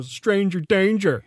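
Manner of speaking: mock warning. Warning kids about strangers.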